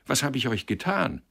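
put-on upset young man demanding an explanation; dismayed. A narrator's reading. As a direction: ask in bewildered dismay. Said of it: "rief Sender bestürzt".

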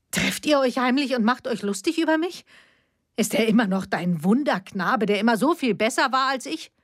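high, hurt, accusing; bitter accusation. A narrator's reading. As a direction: throw the old jealousy at her. Jealous accusing daughter.

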